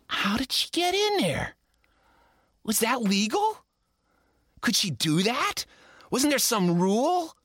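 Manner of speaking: high, incredulous questions; shocked disbelief. A narrator's reading. Narrator reliving the shock, high and incredulous. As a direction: ask in outraged kid disbelief.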